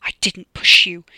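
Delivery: loud, hoarse-whispered snarl; snarl through gritted teeth. A narrator's reading. Killer snarls his denial of pushing her, through gritted teeth. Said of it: "he snarled through gritted teeth".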